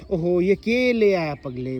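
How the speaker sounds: slow, changed voice of a startled grandmother; ohho, drawn out in disbelief; lightly voiced old woman, surprised; amazed old woman. Shown as a demonstration. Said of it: "वो हैरान हो गई चिमटे को देख के".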